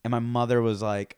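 normal talking voice

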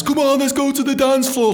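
high, eager rallying voice; rally the staff onto the floor; very high mock party cheer; excited party call. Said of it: "so it was".

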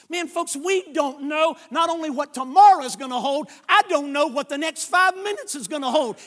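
loud, high fervent sermon voice; full-force preaching. Acted. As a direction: preach it hard to the congregation.

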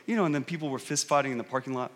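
low, quick, soft aside; mumbled under breath. Retold or imitated. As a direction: slip it in quietly, as if hoping nobody notices. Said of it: "kind of mumbles under his breath".

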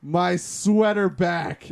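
slow mock demand; mock whiny demand. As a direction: stretch it out like a petulant demand. Demanding sweater back from ex.

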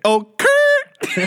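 very high, loud squealed catchphrase; high-pitched rapper catchphrase, hammed up. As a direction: squeal it out with an attempted trill. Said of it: "Give me an impression of [name]".

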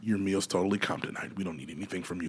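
soft, low, polite restaurant staff; hushed waiter voice. Pretend. Waiter quietly comping a star's meal, hushed and polite. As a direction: murmur it like a waiter bringing a free bottle.

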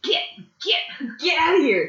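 high yelled get off my lawn; grumpy old man. Retold or imitated. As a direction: chase the kids off, cranky. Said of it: "like an old man trying to get kids out of his yard".

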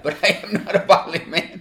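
amused protest, half laughing. Real, not acted. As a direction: chuckle your way through the line. Joking that he isn't violent.

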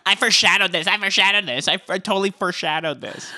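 high-pitched mocking imitation; mimicked insistence. Mimicking a cast member's repeated claim.

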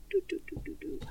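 very quiet, toneless do-do-dos; idle filler. Real, not acted. Searching for a release date, idle quiet filler. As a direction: fill time under your breath.

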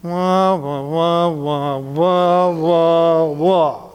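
wah wah repeated back verbatim; garbled wah wah with attitude; muffled wah wah talk. Shown as a demonstration. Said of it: "I heard you say".

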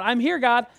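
loud, high, eager; jumping up and down. Pretend. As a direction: bounce with excitement as you offer. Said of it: "jumping up and down".

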